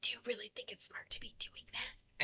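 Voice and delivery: unvoiced, hissed urgent whisper; whisper yelling. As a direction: whisper it like a shout. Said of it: "whisper yelling".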